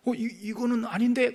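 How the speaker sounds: flustered dismay. Retold or imitated. Jonah dismayed at repentance.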